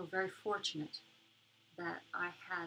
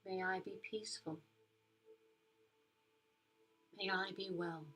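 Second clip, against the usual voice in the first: soft spa whisper; 4 dB quieter than usual. Acted. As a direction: guide them gently into calm. Leads a metta meditation.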